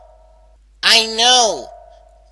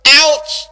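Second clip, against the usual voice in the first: ouch of pain; 3 semitones higher, 5 dB louder than usual. Acted. Tooth pain sudden jolt.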